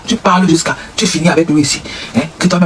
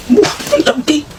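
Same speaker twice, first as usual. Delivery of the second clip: high mock voice; 10 semitones higher, slower than usual. Voicing the man.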